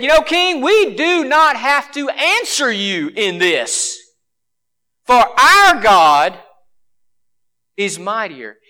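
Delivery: slow, emphatic stand against a king; defiant proclamation, each word weighted; bold defiance. Retold or imitated. Hebrews defying the king, acted.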